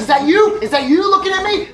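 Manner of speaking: high, loud eager questions; excited questioning. Retold or imitated. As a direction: call out in hopeful disbelief.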